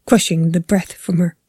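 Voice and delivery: ordinary delivery, nothing marked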